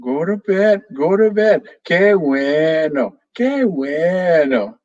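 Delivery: high parroting, then slow drawn-out qué bueno; parrot repeating. Acted. Parrot Pepe repeats Vero's order, high, then slow pleased parrot chant. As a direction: echo it back like a happy parrot. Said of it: "Pepe repeated".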